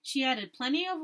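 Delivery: usual speaking voice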